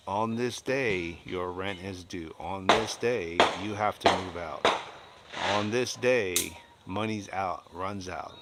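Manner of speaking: slow, deliberate, each "On this day" hammered; slow emphatic rules. Retold or imitated. Lecturing renters slowly, each "day" landing hard. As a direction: spell out the rules slowly like a landlord.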